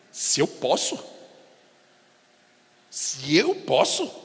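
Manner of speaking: slow, questioning repeat; incredulous echo. Acted. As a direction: echo his words back, slowly. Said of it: "respondeu mais ou menos com um tom assim".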